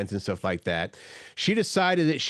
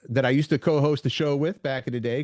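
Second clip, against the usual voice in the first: ordinary delivery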